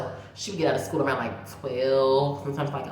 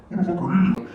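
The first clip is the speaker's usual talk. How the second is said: louder, sassy challenge in the snake's voice; snake's sass; 2 semitones higher, 6 dB louder, faster than usual. Retold or imitated. The snake sizing them up, voiced with mock sass. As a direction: give the snake some attitude, lightly voiced. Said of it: "It's like".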